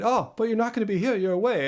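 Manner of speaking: light Scottish lilt, correcting a friend; Scottish accent. Retold or imitated. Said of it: "did you like my little Scottish accent there".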